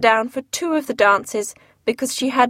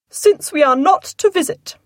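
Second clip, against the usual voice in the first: higher, louder indignant complaint; angry cry; 7 semitones higher, 4 dB louder than usual. A narrator's reading. Wife finishing her angry complaint, higher and louder. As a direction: let the indignation rise at the end. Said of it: "cried Missus Bennet angrily".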